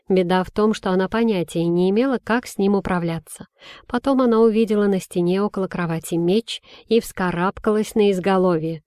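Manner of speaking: ordinary delivery, nothing marked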